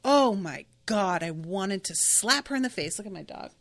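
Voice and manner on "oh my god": ordinary talk, nothing marked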